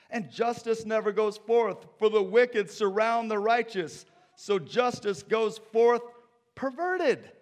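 loud, high impassioned scripture reading; preached lament. Acted. Preacher crying Habakkuk's complaint to God. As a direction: cry it out to the Lord.

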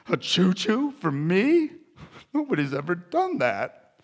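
touched villain, voice rising in wonder; put-on voice melting from surprise into softness; Winter Warlock. Warlock receiving a toy train, disarmed wonder. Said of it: "And he melts".

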